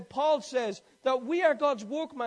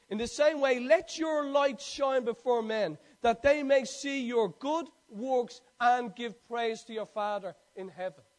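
slow, louder measured reading of scripture; 5 dB louder, slower than usual. Real, not acted. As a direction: lay each phrase down slowly like a proclamation. Reads scripture slowly and emphatically.

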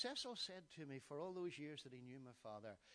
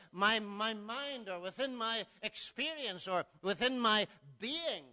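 loud, higher pulpit voice; full-force preaching; 7 semitones higher, 14 dB louder than usual. Acted. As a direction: press the point on the congregation.

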